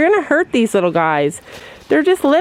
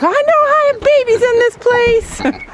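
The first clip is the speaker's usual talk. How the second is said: high squeaky goat voice; voicing the goat. Narrating her buck's thoughts in a high goat voice. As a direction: speak for the goat in a high, silly voice. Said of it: "He's like".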